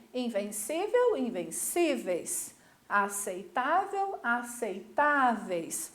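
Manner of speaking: "invencível, invencíveis", "Aceitável, aceitáveis" drawn out on CÍ and TÁ; very slow, leaning on CÍ in "invencíveis" and TÁ in "aceitáveis"; stress before last syllable. Shown as a demonstration. Teacher modelling plurals, "invencível, invencíveis", "Aceitável, aceitáveis" slowly stressed on the accented syllable. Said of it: "a acentuação é que vai mudar".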